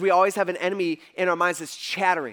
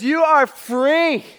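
loud, slow and higher, each word stretched; God's proclamation; 7 semitones higher, 8 dB louder, much slower than usual. Acted. Preacher voicing God to Adam and Eve, loud slow proclamation. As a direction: proclaim freedom like a booming father. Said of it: "he says".

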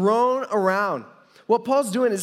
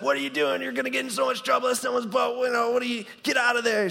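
imitating freak-out; 6 semitones higher, faster than usual. Girlfriend scolds him for jumping in the boat, mock freak-out. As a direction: act out her panicked scolding in a raised pitch. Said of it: "she actually starts freaking out".

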